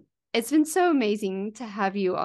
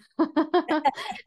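1 laughter; 10 semitones higher, 6 dB louder than usual. Real, not acted. Host laughs delightedly.

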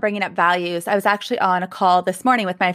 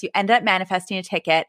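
ordinary talk, nothing marked

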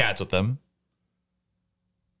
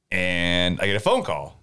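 ordinary talk, nothing marked

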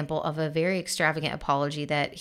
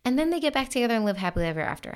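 higher, fast, sing-song sarcasm; mock fairy-tale ending; 7 semitones higher, much faster than usual. Host teasing listeners with a fake happy ending, sarcastic. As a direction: fake the happy ending before pulling the rug. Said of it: "no, obviously not".

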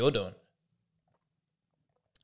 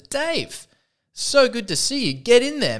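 much higher, louder gushing greeting; overjoyed bouncer shouting a welcome, pitched way up; enthusiastic bouncer; 11 semitones higher, 6 dB louder, slower than usual. Acted. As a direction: greet him like your best regular. Bouncer greets Dave at club.

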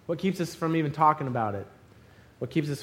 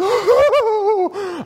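high, loud gleeful whoop; acted high-pitched cry of excitement; excited whoop; 8 dB louder than usual. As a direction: whoop in triumph at a catch. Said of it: "And I'm excited".

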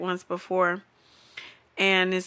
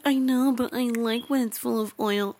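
put-on cutesy girlfriend; 3 semitones higher than usual. Retold girlfriend's cutesy answer.

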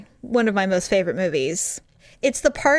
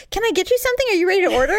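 lightly voiced waiter, higher; a bright polite waiter, higher; 9 semitones higher, much faster than usual.

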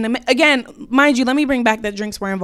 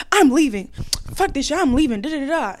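mock frantic, pitched up; imitated frantic outburst; 4 semitones higher than usual. Friend announcing an exit at the door, mock frantic. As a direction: imitate a friend storming out in a fluster. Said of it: "T just goes out frantically".

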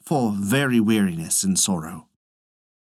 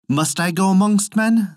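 put-on higher-pitched boy's plea; questioning young son; 7 semitones higher, 3 dB louder than usual. A narrator's reading. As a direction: ask father as an uncertain son, pitched up. Young son questions his father.

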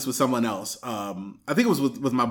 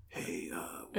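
much softer, mumbled come-on; awkward dude; 10 semitones lower, 17 dB quieter than usual. Retold or imitated. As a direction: mumble a clumsy pickup. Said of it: "dude she interacts with is like".

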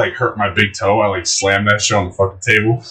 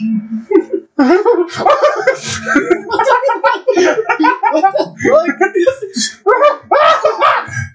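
helpless laughter at the joke; big laugh. Real, not acted.

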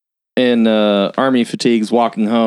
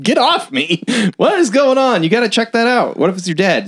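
high-pitched imitated panic; mock alarm; 12 semitones higher than usual. Projectionist pushing Pearl off after a thump, mock alarm. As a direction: raise the pitch sharply, fending her off.

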